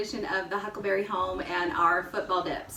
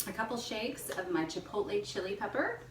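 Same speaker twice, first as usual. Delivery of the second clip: ordinary delivery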